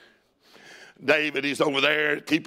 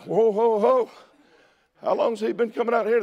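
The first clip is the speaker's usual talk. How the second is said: higher-pitched acted disbelief; startled protest; 7 semitones higher than usual. Preacher as young David hearing the defiance, startled protest. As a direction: pull everyone up short, pitched high.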